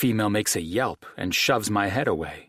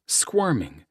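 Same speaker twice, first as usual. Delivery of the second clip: ordinary delivery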